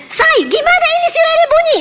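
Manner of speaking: high-pitched mock husband's yell; retold panicky yell. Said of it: "Suami saya teriak".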